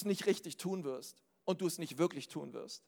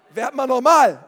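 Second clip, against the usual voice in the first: loud, higher exasperated outburst; genervter Ausruf; 4 semitones higher, 16 dB louder than usual. Acted. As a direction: shout it as a fed-up wife cutting off her husband. Acted wife snaps at husband. (laut und genervt gerufen)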